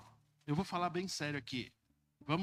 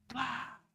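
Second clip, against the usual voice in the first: slap sound; 9 semitones higher than usual. Shown as a demonstration. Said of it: "meter um tabefe na orelha".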